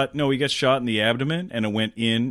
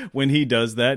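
normal talking voice

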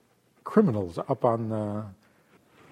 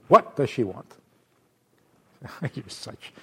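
usual speaking voice

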